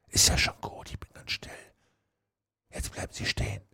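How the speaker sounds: very soft, voiceless whisper. A narrator's reading. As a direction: whisper a secret report from hiding. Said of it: "Mit dem Tuscheln war's jetzt aus".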